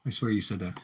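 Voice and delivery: quiet muttered repeat; mutter to self. Real, not acted. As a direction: say it under your breath.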